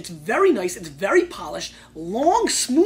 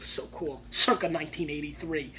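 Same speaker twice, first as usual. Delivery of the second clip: usual speaking voice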